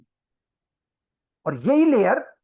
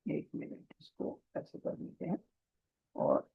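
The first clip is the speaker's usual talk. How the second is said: whispered aside to herself, much softer; muttered aside; 14 dB quieter than usual. Real, not acted. Teacher fixing the board drawing, whispering to herself. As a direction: breathe it under your breath.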